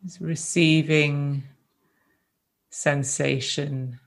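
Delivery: gentle, unhurried meditation guidance with long silences between phrases; guided meditation. Real, not acted. Teacher guiding a group to rest in the body, calm meditation voice. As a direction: ease them into stillness, leaving space after each phrase.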